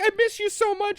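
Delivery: very high, hammed-up tearful voice; mock crying. Stacy tells Steve she misses him, mock crying. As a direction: play at crying in a high squeak. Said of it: "she's like, starts crying".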